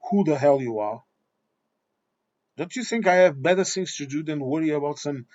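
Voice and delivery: usual speaking voice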